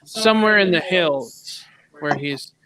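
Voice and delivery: ordinary talk, nothing marked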